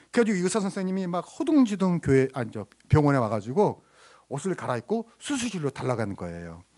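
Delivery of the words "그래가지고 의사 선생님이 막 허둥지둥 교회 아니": ordinary talk, nothing marked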